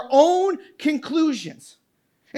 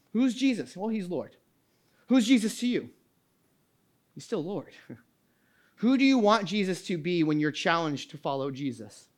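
usual speaking voice

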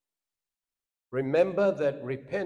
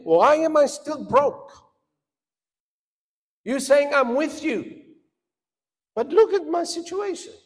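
higher, louder aggrieved plea; complaining believer; 9 semitones higher, 6 dB louder than usual. Acted. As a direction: protest to God as if wronged. Acted complaint of struggling believer.

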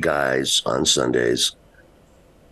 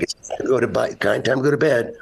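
lightly acted dad, pitched up; higher-pitched mock parental announcement; parent's bedtime instruction; 11 semitones higher than usual. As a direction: tell a small child it's bedtime. Acts himself as the parent.

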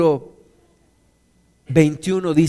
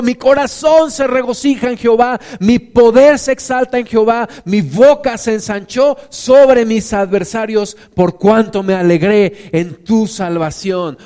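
joyful praise; 5 semitones higher, 6 dB louder than usual. Acted. Ana's song of praise read.

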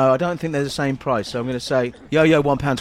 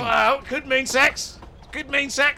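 high, squeaky little man; squeaky character voice; 11 semitones higher, slower than usual. Acted. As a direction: play a silly tiny man, pitched up.

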